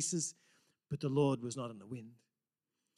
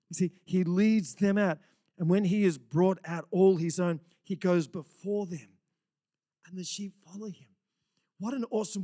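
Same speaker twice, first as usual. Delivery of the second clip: louder full-force preaching; 6 semitones higher, 7 dB louder than usual. Acted. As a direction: drive it home from the pulpit.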